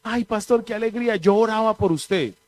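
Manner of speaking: high-pitched effusive sister; imitated gushing churchwoman. Church sister telling pastor she prayed for him, imitated gushing. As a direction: mimic a sister fussing over the pastor. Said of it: "la hermana de pico babión".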